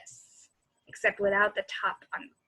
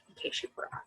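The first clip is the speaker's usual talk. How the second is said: whispered afterthought; soft whispered aside; 11 dB quieter, faster than usual. Real, not acted. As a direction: tease them softly in a whisper. Aside to the listening children.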